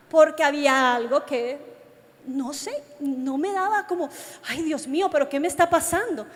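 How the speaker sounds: normal talking voice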